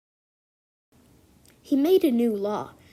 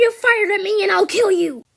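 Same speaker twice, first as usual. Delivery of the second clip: furious threat; 9 semitones higher, 9 dB louder than usual. Acted.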